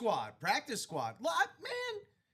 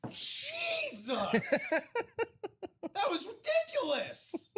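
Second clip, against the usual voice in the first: laughing shout; 3 dB louder, slower than usual. Real, not acted. Laughing at crazy roll.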